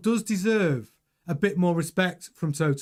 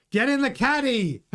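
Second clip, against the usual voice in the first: hammed-up, higher-pitched American accent; 5 semitones higher than usual. Shown as a demonstration. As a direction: call it out in a bad American accent. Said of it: "my American accent".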